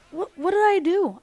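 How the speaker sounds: high, loud self-defence; retold baffled indignation; indignant protest. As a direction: protest in mock disbelief at being blamed. Retold quarrel, protesting the blame.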